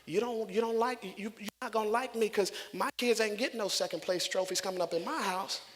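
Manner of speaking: ordinary delivery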